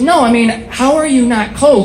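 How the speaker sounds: exaggerated female passer-by; incredulous woman. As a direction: imitate her disbelief with a high, swooping question. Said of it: "And she goes".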